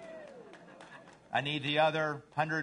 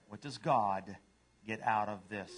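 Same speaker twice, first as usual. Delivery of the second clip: low, understated question with pauses; humble dog; 6 semitones lower than usual. Acted. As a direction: ask it low and unhurried. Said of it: "And a dog says".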